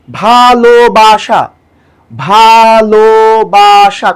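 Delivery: ভালোবাসা drawn out as "bha-lo-ba-sha"; slow syllable by syllable. Shown as a demonstration. Teacher demonstrates syllables, ভালোবাসা very slowly drawn out. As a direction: stretch each of the four beats of ভালোবাসা. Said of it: "ধীরে ধীরে উচ্চারণ করব".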